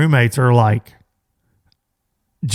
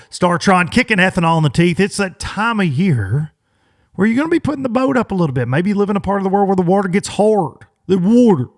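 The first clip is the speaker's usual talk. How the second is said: higher, punchy sponsor read; 7 semitones higher, 4 dB louder, faster than usual. Acted. Host reading sponsor plug to listeners, upbeat ad read. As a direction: sell it like a radio spot, upbeat and quick.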